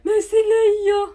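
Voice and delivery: high, loud, drawn-out mock objection; mocking doubters' protest. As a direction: imitate stubborn doubters, loud and stretched out. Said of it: "le monde sont encore accrochés sur le fait que". (protestation moqueuse, aiguë et traînante)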